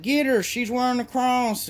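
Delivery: high, frantic call to grab her; mock panicked mob. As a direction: squeal it like a panicking crowd. Mob freaks out at cross.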